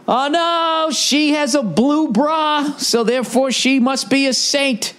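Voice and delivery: sarcastic high mock voice, slow on the bra line; mocking falsetto. Comic mocking the news praise of a candidate in a high sarcastic voice. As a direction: sneer it out in a squeaky put-on voice.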